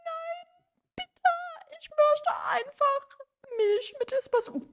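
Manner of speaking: very high, hammed-up whimper; tearful pleading. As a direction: beg in halting German, fake-crying. Pleading to keep speaking German.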